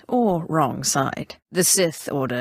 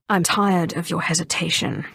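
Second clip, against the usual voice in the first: ordinary talk, nothing marked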